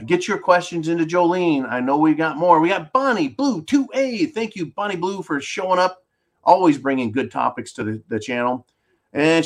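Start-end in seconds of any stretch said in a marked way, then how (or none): none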